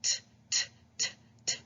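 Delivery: short unvoiced taps, t t t; tapping T sound. Shown as a demonstration. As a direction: tap the tongue, t. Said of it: "it's like this".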